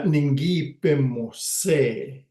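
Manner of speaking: "Ningi pimose" spelled out slowly, each syllable clear; careful slow demo. Shown as a demonstration. Teacher modeling the past tense for learners, slow and careful. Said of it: "teaching the word".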